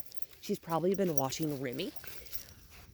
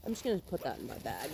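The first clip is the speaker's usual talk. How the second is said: much softer, murmuring close to the dog; 14 dB quieter than usual. Real, not acted. Trainer softly telling the puppy about her collar.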